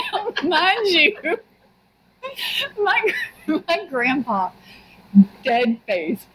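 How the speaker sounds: talking through real laughter; high, slow, breaking up with laughter; laughing. Real, not acted. Friend cracking up over grandpa's story, laughing as they talk.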